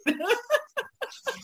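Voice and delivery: long high laughter. Real, not acted. Laughing at Michael Jordan with Looney Tunes, high laugh.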